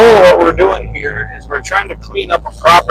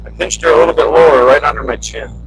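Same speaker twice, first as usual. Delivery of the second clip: ordinary talk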